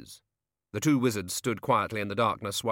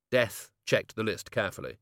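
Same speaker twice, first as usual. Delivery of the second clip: plain reading voice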